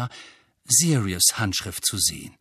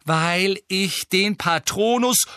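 slow, raised, emphatic reply; Harry, exasperated; 7 semitones higher, 3 dB louder, slower than usual. Acted. Nephew explains his Patronus to uncle, exasperated. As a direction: stress each word in exasperation.

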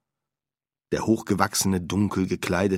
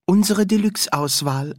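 high shopkeeper voice; 10 semitones higher, 4 dB louder than usual. Acted. Shopkeeper voice in story.

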